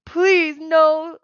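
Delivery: high, loud begging moan; pitiful moaning plea. Acted. Beaten Cyclops begs Percy, pitifully moaning. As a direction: plead for your life, whimpering. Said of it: "the Cyclops moaned pitifully".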